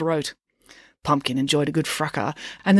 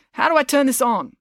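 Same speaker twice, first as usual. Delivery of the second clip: high-pitched, puzzled announcer; flustered principal; 10 semitones higher, 3 dB louder than usual. A narrator's reading. Mister Lang struggles with the PA, flustered. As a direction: fumble with it, pitch raised.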